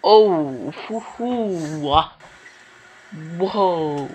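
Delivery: loud, stretched-out whoops of astonishment; whoop, stunned by a wild shot; amazed whooping. Real, not acted.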